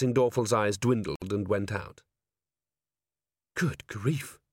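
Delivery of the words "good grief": breathy, hushed gasp; breathed. A narrator's reading. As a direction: breathe it out softly. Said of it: "breathed Angua".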